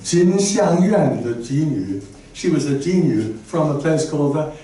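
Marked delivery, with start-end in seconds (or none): 0.0-1.2 Jin Xiang Yuan said slowly in Chinese; slow careful Chinese reading; Chinese name. Shown as a demonstration. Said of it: "you'll see it on the top line".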